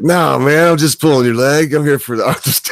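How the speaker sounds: loud, high, gleeful reveal of the joke. Retold or imitated. Telling the anesthetist it was just a joke, loud and gleeful. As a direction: burst out laughing at the prank.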